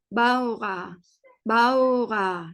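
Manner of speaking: "Bawa" drawn out as bau-a, R softened; German pronunciation demo. Shown as a demonstration. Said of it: "R ise yumuşak G olarak çıkarıyoruz".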